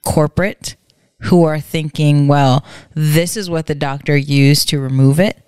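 ordinary delivery

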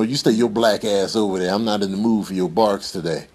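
ordinary talk

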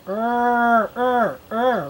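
held "rrr" growls for R; letter sound. Shown as a demonstration. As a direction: draw out the sound of R three times. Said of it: "I wanna hear you say it".

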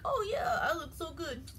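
high, pleased self-admiration; vain admiring. Retold or imitated. Jefferson admires himself in the mirror, high and pleased. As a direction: coo at the mirror. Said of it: "he's like".

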